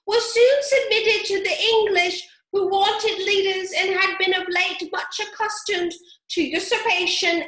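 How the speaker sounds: high, loud pompous lecture; self-important mouse. A narrator's reading. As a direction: recite it like a proud schoolmaster. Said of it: "said the mouse with an important air".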